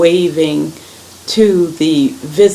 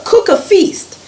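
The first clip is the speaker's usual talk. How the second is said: much higher, excited order; eager command; 14 semitones higher than usual. A narrator's reading. Abraham tells Sarah to cook for visitors, eager command. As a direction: urge her on to a feast.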